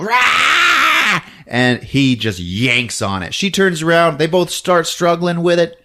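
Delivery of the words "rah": high, drawn-out screech; hammed-up monster scream; mock roar. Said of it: "and she's all".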